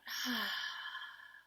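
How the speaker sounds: long, audible sigh out; big relaxing exhale; demonstrated out-breath. Shown as a demonstration. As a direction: let the whole breath fall out in a sigh. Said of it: "and then breathing out".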